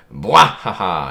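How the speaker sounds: loud mock belly laugh, spelled out; hammed-up hearty laugh. Reader voicing Garp's entrance laugh, hammed-up. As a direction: act out a big comic-book laugh. Reads a character's big laugh.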